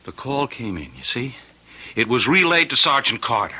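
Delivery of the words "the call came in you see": hushed explaining. Acted. Husband lowering voice to explain.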